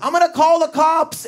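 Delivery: high whiny threat to call cops; Steve threatening. Retold or imitated. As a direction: squeal it like a flustered old guy. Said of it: "he'd always go like this".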